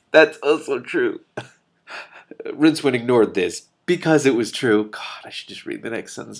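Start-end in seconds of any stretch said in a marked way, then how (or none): 0.1-1.4 slow, amused chuckle through the words; laughing. Real, not acted. Reader laughing at Hrun's jab, amused. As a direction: laugh along with the joke.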